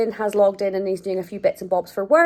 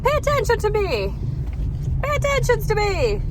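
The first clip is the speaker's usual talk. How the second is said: voicing the dog; 10 semitones higher than usual. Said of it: "He's like".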